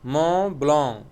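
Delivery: "Mont Blanc" pronounced as French, with silent t and c; "Mont Blanc" as a French name, endings left silent; French pronunciation. Shown as a demonstration. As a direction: demonstrate "Mont Blanc" the French way, nasal vowels. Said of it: "so we will pronounce it as".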